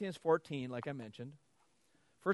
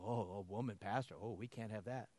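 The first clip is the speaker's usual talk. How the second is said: lower, softer mock disapproval; mock critics; 4 semitones lower, 8 dB quieter than usual. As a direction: imitate the sniffy critics. Imitating critics.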